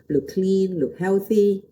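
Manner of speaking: ordinary talk, nothing marked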